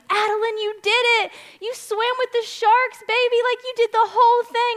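high, loud, gushing cheer for her daughter; thrilled praise. Retold or imitated. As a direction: cheer her on like she just won gold. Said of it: "I'm so excited for her".

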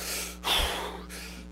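long, forceful audible exhale; breathing up. Shown as a demonstration. Father demonstrating breathing up before the tunnel, big exhale. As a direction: blow all the air out hard. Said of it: "so I'm breathing up".

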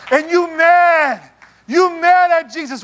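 preacher's emphatic punch line. Real, not acted. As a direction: lean hard on mad. Preacher driving the point.

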